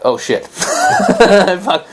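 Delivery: slow, laughing oh shit; laughing curse. Retold or imitated. Retelling his shock at the paid booking, laughing. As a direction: caught out, laughing in disbelief. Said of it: "and I'm like".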